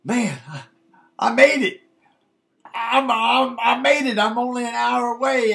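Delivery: high, loud burst of relived excitement; energized elation. Retold or imitated. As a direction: let a rush of electricity lift the voice. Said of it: "this rush of electricity hit me".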